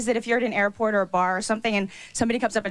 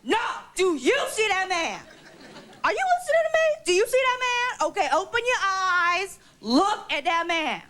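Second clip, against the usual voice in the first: talk-show guest confrontation; 8 semitones higher than usual. Pretend. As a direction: warn the rival off your man.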